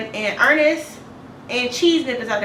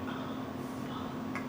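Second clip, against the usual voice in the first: hushed curse; 18 dB quieter than usual. Retold or imitated. Hiding neighbor at door, retold.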